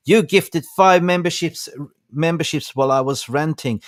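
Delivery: light up at the gift; excited thanks. Real, not acted.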